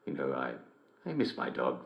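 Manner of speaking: very soft, subdued confession; wistful. Acted. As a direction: let the show voice drop away, hushed. Host suddenly wistful mid-show.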